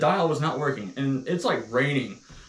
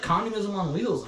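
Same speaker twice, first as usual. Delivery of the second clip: ordinary delivery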